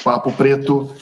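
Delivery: ordinary talk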